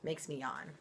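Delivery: plain reading voice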